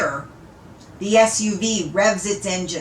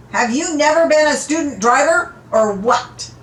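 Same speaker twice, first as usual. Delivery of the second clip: higher, louder angry challenge; Ovey yanks the door open and confronts the driver; angry confrontation; 5 semitones higher, 4 dB louder than usual. Acted. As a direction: tear into the driver.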